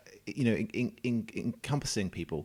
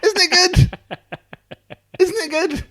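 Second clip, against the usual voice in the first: laughing; 14 dB louder, slower than usual. Real, not acted. Delighted by his own dog joke.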